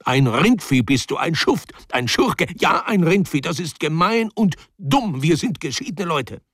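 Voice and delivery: high-pitched indignant tirade; angry outburst. Acted. Man breaking with Mitja after failed rendezvous, furious. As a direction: hurl the insults in an acted high-pitched fury.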